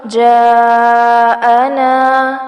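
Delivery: slow chanted جاءنا with both long vowels stretched; جاءنا intoned with long madd. Shown as a demonstration. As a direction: draw out the جا and نا in جاءنا. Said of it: "جا مد ہو رہی ہے".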